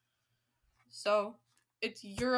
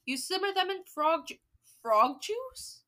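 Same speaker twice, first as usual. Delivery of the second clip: baffled reading; 7 semitones higher, 5 dB louder than usual. Real, not acted. Reader baffled by frog juice.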